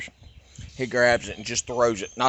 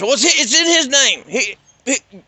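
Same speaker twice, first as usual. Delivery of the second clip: high, louder whiny father voice; mocking his dad; 12 semitones higher, 7 dB louder than usual. Dad answers the cop.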